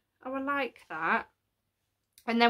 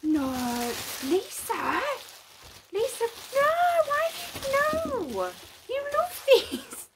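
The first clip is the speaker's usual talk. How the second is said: overwhelmed squeal; 14 semitones higher, 3 dB louder than usual. Real, not acted. Overwhelmed by an expensive gift.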